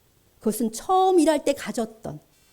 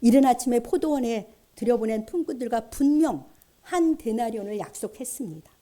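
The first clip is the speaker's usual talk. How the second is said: ordinary delivery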